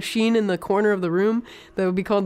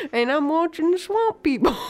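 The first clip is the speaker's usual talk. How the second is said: mock old-timer reminiscing; old pappy voice; 8 semitones higher than usual. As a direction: ham up a creaky country grandpa. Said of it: "I don't know why I'm doing that voice".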